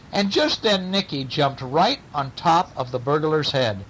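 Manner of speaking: plain reading voice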